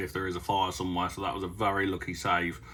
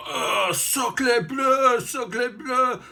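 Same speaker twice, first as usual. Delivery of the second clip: very high, drawn-out shouted "sacre bleu" in a put-on French voice; hammed-up French exclamation, slow and shouted; mock French farmer; much slower than usual. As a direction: ham up an exasperated Frenchman with a stuck combine. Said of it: "And then he's like".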